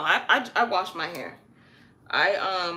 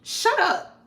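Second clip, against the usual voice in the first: higher, louder curt shut up; blunt shut up; 13 semitones higher, 7 dB louder than usual. Shown as a demonstration. Imitating someone rudely telling her to shut up, high and loud. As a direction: snap it with no other words. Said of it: "imagine you're talking and somebody goes".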